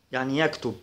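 ordinary delivery

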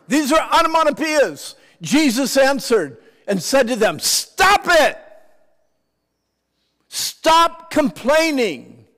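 high, loud fervent preaching. Acted. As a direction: shout the command. Preaching at full force.

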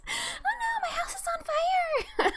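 very high, squeaky fake-crying voice; hammed-up high-pitched lament; mock wailing victim. Player imitates neighbor whose house is on fire, mock wail.

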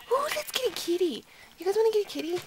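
high-pitched, eager cooing; excited squeal. Real, not acted. Spots chance to get a kitten.

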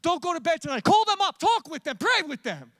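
high, loud pressing command; urgent voice of God. Acted. God urging a believer to call someone, loud urgent command. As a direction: push them to act now. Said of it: "When he says".

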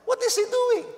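very high and loud, aghast; disbelieving shout at a leader's boast; incredulous outcry. Retold or imitated. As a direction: cry out in total disbelief. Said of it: "I said".

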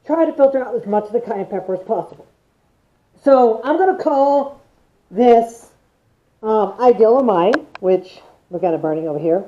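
muffled behind respirator; speaking inside full respirator; through a gas mask. Real, not acted. Seen 2: Dual-filter respirator on face in smoky frames; earlier frames show pan.

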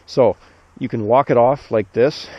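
ordinary talk, nothing marked

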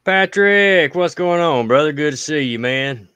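high, loud hearty hello; big booming welcome; excited greeting. Real, not acted. Calling out greeting to viewer.

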